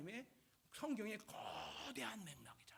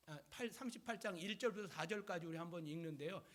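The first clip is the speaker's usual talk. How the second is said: normal talking voice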